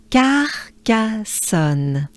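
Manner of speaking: Carcassonne drawn out slowly, syllable by syllable. Shown as a demonstration. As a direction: stretch out Carcassonne.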